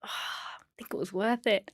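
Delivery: tender whisper; hushed awe at the baby. Retold or imitated. Mum gazing at newborn, whispering softly.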